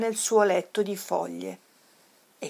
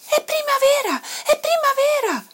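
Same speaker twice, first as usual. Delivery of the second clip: high, loud cub's shout of joy; excited cry; 6 dB louder than usual. A narrator's reading. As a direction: cry out the news in a high child's voice. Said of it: "gridò Toddy".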